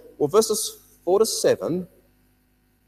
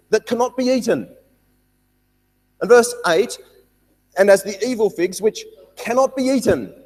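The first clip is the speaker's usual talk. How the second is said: higher, louder preaching on the bad figs; emphatic preaching; 6 semitones higher, 7 dB louder than usual. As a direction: drive home that they could not be eaten.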